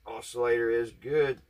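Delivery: ordinary delivery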